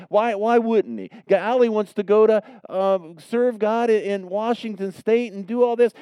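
high, loud pleading why; anguished questioning. Real, not acted. Pastor voicing his own anguish over Allie, pleading loudly. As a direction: cry out the question to God in frustration. Preacher cries out why to God.

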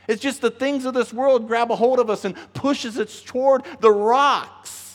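ordinary delivery

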